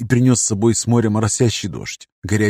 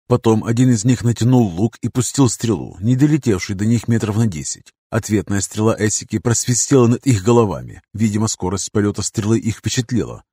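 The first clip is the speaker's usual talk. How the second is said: ordinary delivery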